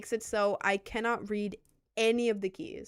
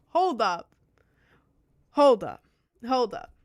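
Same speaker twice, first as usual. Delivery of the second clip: loud, punchy hold up; mock outrage, each hold up hit hard; 2 semitones higher, 9 dB louder, slower than usual. Real, not acted. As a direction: bark it out in protest. Repeated protest.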